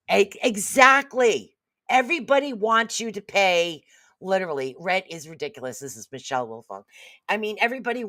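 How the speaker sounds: ordinary talk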